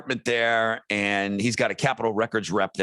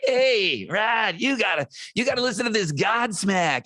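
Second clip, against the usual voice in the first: higher, eager New Orleans-tinged pitch; pushy music fan pitching a band; imitating Franco; 8 semitones higher than usual. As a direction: sales manager bugging the radio guy, higher and eager. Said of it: "I can't do his accent".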